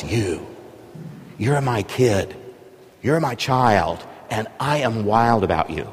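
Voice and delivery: soft, intimate voice speaking to the heart; inner voice. Acted. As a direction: say it gently, as if heard within. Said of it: "a voice inside him that speaks to his heart".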